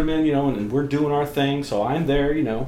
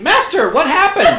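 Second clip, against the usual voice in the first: mock panicked sub; 13 semitones higher, 8 dB louder than usual. Retold scene, submissive voiced.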